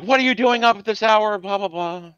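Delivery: high scolding mother; lightly voiced nagging mom. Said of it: "she's, like".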